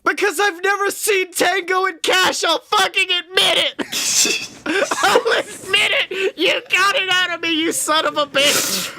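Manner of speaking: high, giggling through the words; real laughter, caught out and owning it; laughing confession. Real, not acted. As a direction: laugh out the admission you've been hiding. Caught admitting, cracking up.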